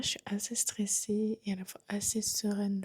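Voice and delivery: ordinary delivery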